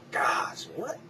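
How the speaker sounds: breathy whispered exasperation; whispered gosh. Real, not acted. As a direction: exhale it under your breath. Exasperated exhale.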